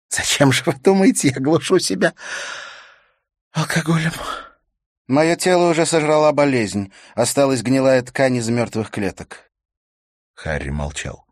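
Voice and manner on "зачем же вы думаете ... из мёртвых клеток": high, loud woman's outburst; high-voiced acted despair; distraught widow. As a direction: pitch it way up, bitterly confessing. Sick widow's bitter outburst.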